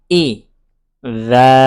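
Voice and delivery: إذا drawn out very slowly; إذا spelled out slowly, one letter at a time. Shown as a demonstration. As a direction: sound out each letter of إذا separately. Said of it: "তাহলে পড়তে হবে".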